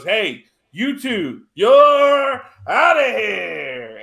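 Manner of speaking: slow, drawn-out call rising high and loud; ref's call. Retold or imitated. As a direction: bellow it like a referee ejecting them. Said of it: "the ref goes".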